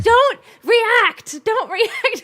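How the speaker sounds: high, loud, panicked inner voice. Retold or imitated. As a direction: squeak it out in panic. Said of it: "in my head, I'm like".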